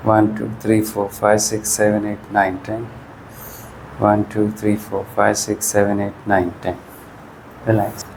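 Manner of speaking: steady, low counting that fades out toward the end of each set; exercise count. Shown as a demonstration. Physio counting viewers through an isometric wall push, steady count. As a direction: count the hold out evenly, like a physio.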